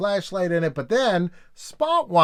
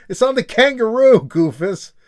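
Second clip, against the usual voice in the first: mock excited; 7 semitones higher, 8 dB louder than usual. Answering Goofus.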